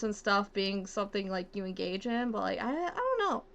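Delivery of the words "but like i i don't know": ordinary talk, nothing marked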